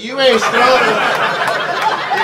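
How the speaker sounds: exaggerated imitation, stretched and mocking. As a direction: drag it out like an exaggerated sassy woman. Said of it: "It's like".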